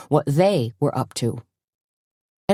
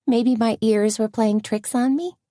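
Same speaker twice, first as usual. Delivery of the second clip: higher, lightly voiced friend; a higher lilt for Tony; Tony's voice; 6 semitones higher than usual. A narrator's reading.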